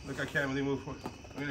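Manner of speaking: ordinary talk, nothing marked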